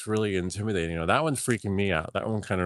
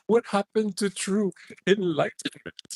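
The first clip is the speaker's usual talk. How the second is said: mock Indian accent; 11 semitones higher than usual. Mocking the yogi's donation basket.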